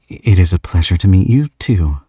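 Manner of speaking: usual speaking voice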